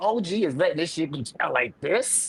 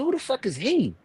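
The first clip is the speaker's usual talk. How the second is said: indignant; 10 semitones higher than usual. Retold or imitated. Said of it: "I said".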